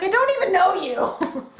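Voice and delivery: laughing Keith impression. Fan mimicking Keith dismissing Lance, laughing. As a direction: giggle through a blank brush-off. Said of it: "And Keith's like".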